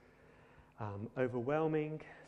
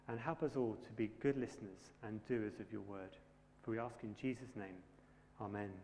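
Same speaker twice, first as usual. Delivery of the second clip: quiet, earnest prayer voice, a little lower; 4 semitones lower, 5 dB quieter than usual. Acted. Preacher praying for the congregation before the sermon, quiet and earnest. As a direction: pray softly to the Father.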